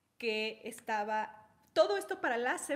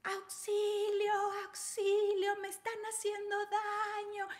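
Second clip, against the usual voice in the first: gritos de auxilio; 10 semitones higher, 3 dB louder than usual. Retold or imitated. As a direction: scream for help like a scheming victim. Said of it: "es gritar". (gritando auxilio, fingida y aguda)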